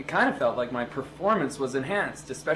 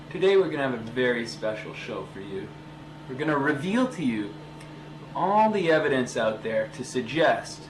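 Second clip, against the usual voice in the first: usual speaking voice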